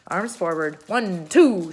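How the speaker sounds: loud drill count; military command. A narrator's reading. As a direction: call out like a sergeant. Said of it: "she cried".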